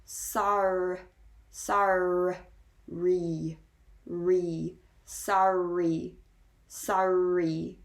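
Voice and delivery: SORRY split as sar, re, then joined with both R's; both R's pronounced. Shown as a demonstration. Teacher breaking down the double R for learners, both R's pronounced. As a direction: sound out sorry slowly with two clear R's. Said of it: "you do pronounce both R's".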